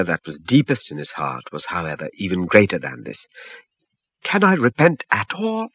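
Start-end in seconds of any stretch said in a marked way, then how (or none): 4.2-5.7 high, pleading cry of distress; man begging the prophet, high and distressed. A narrator's reading. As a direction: beg with mounting fear. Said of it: "he cried, very distressed".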